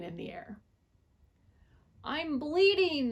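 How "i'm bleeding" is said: high, loud, slow yelp. A narrator's reading. Fox yelping on waking from the gas. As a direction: cry out in alarm. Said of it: "the fox yelped".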